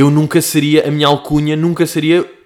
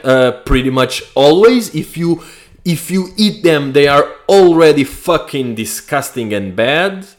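disgusted sarcasm; 6 dB louder, slower than usual. Real, not acted. Sarcastic answer to search result.

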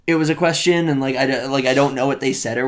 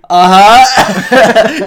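laughing uh-huh; 7 semitones higher, 11 dB louder than usual. Retold or imitated.

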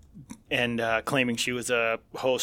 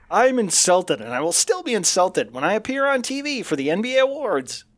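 mock offended voice; 8 semitones higher, 4 dB louder than usual. Mocking the reporter's reply.

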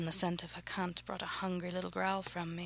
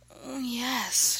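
higher-pitched, contented murmur; pleased murmur; 6 semitones higher, 4 dB louder than usual. A narrator's reading. Contented woman to her lover, pleased murmur. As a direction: let it out as a drawn, pleased murmur. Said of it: "she murmured".

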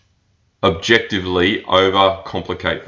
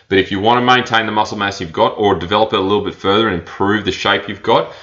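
usual speaking voice

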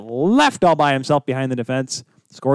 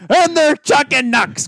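high, drawn-out catchphrase yell; battle-cry catchphrase; 11 semitones higher, 3 dB louder, slower than usual. Acted. Acting out his tag team's signature move, high mock battle cry. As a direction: belt it out like a wrestling catchphrase.

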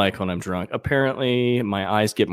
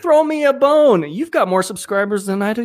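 mock pleading; 10 semitones higher than usual. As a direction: beg a friend in hammed-up exasperation.

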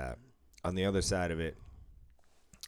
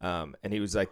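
usual speaking voice

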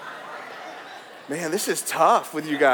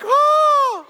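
mock delighted gushing; 9 semitones higher, 4 dB louder than usual. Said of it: "And you're like".

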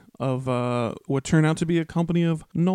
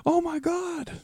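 high, thrilled gasp of wonder; excited squeal; 5 dB quieter, much slower than usual. Retold or imitated. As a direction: squeal it like a starstruck kid. Re-enacting Sam's delight at elves.

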